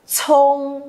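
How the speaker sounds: "chông" with a puff of air after the initial; aspirated. Shown as a demonstration. Teacher shows it is not an s sound, aspirated "chông". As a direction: breathe out hard on the start of "chông", then hold it level. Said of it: "bật hơi ra cho cô".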